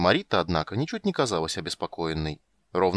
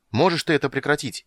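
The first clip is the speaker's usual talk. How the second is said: louder, higher shout over noise; 6 semitones higher, 5 dB louder than usual. A narrator's reading. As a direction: shout over the ringing sword. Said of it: "крикнул Танис". (крик сквозь звон)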